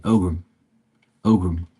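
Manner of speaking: "Ogham" said twice as OH-gum; pronunciation practice. Shown as a demonstration. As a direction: say "Ogham" as OH-gum, stress on the first syllable. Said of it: "That's how you say it".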